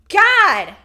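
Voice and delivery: loud exasperated "God"; exasperated shout. Real, not acted. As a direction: blurt it out, fed up. Exasperated outburst.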